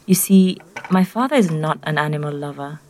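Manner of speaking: plain reading voice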